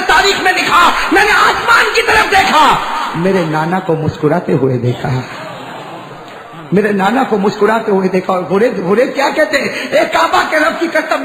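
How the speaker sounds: high, loud preaching cry; preacher crying out the martyr's triumph; impassioned preaching. Acted. Preacher to congregation, climactic high loud cry.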